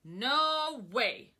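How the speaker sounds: loud firm refusal; farmer refusing. Acted. Farmer Brown refusing the cows, loud and firm. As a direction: flatly shut them down. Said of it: "said Farmer Brown".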